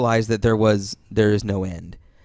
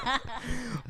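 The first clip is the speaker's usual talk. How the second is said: high burst of laughter; 12 semitones higher, 12 dB quieter than usual. Real, not acted. Storyteller laughing at own seaman story, high. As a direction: laugh hard at the punchline.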